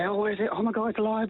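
high, excited pretend amazement; playful hype. Shown as a demonstration. Trainer demonstrating waving the toy for the dog, playful hype. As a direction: play-act wild surprise to wind up a dog. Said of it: "wave it around and say".

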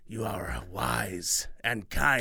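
slow whispered crone; breathy hushed praise. Acted.

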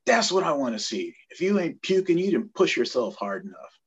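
captain's loud approval. Retold or imitated. Captain to the man who threw up, loud approval. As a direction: push it out high and loud, easing at the end. Captain barks at puking recruit.

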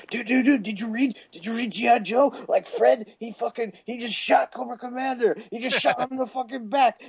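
high-pitched mock fanboy gushing; excited friends retelling. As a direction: imitate a breaking-news moment among friends. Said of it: "a big deal for me and my friends".

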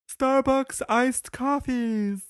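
high, very slow announcer reveal; grand reveal. Acted. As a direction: draw the reveal out dramatically. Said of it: "grand reveal of pick".

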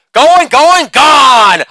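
high, shouted announcer call; home run call. Acted.

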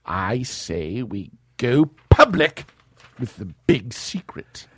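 high, loud villain voice; hammy Lex, indignant; new Lex voice. Acted. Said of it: "a different voice for Lex".

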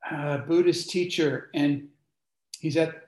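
ordinary talk, nothing marked

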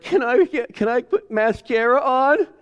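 put-on high begging girl's voice; pleading preteen girl. Voicing a daughter begging her mom for mascara. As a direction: ask sweetly like a preteen. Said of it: "Or maybe she's like".

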